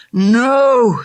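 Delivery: high anguished cry of refusal; desperate no. Acted. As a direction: cry out against waking. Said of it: "said his mind".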